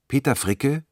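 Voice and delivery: normal talking voice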